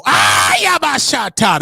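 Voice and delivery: high, loud mocking chant; mock celebratory yell; mock hype shout. Mocking viewers leaving for rival show.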